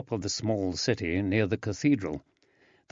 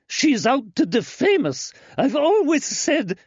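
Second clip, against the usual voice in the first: shrill indignation; 5 dB louder than usual. Acted. Birgitta ranting.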